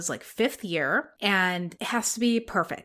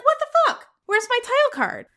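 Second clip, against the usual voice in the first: high, loud mock outrage; a shrill, loud cry of disbelief at a missing title card; exasperated outburst; 5 dB louder, faster than usual. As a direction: blurt it like the title card robbed you. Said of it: "And I was like".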